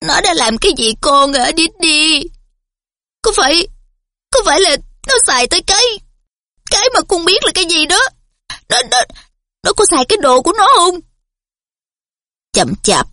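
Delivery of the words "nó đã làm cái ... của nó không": high, fast, shaky fretting; trembling question. A narrator's reading. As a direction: ask in a rush, voice trembling. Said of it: "Dì run run giọng hỏi".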